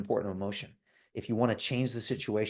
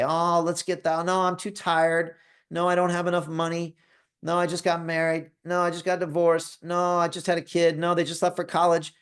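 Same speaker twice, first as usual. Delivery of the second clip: whiny excuse-maker; 7 semitones higher than usual. Retold or imitated. Excuses of the hesitant lion.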